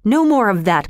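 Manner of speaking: lightly voiced queen giving an order; commanding. A narrator's reading. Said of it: "With a commanding voice".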